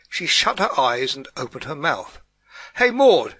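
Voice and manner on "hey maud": high, loud neighbourly holler; shouted hail to a neighbour; roaring call. A narrator's reading. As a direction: bellow it across the gardens. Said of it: "she roared across the adjoining gardens".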